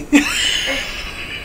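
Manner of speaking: a big burst of laughter. Real, not acted. Laughing at the photos.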